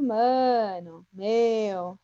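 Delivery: MANO and MEU with stretched vowels; São Paulo drawn-out emphasis. Shown as a demonstration. Teacher showing how São Paulo talks, drawn-out mano, meu. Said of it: "quando a gente alonga uma vogal".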